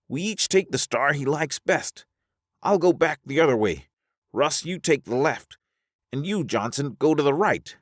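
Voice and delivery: high character voice, giving orders; high-voiced Alvar, decisive. Alvar assigning each a star, high decisive voice. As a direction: hand out directions in a high register.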